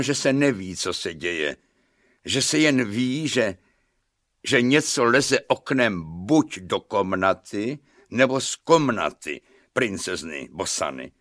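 ordinary delivery

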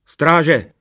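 loud, high-pitched royal summons; commanding yell; calling out. A narrator's reading. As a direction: shout for the guards. King calls for the guards.

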